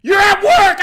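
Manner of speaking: top-volume, high-pitched angry bellow; retold furious shout. Boss yelling at screaming employee, furious shout. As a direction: re-enact losing your temper at full blast.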